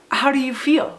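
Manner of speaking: ordinary talk, nothing marked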